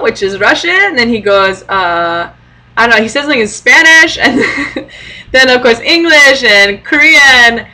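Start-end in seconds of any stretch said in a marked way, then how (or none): 2.8-7.7 laughing through the words; can barely speak for laughing. Real, not acted. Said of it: "I was just laughing so hard".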